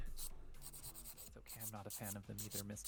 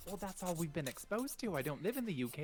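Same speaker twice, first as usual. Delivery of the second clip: put-on higher, stagey posh British accent; bad Downton Abbey British; 5 semitones higher, 4 dB louder than usual. Co-host defends their accent, bad Downton Abbey British. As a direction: protest like an amateur period-drama Brit. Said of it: "You sound like a bad Downton Abbey actor".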